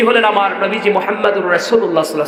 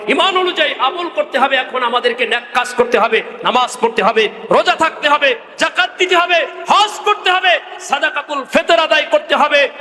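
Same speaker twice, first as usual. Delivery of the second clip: impassioned preaching; 8 semitones higher than usual. Real, not acted. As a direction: list of duties climbing higher item by item. Preaches list of duties. (ধাপে ধাপে সুর চড়িয়ে ওয়াজ)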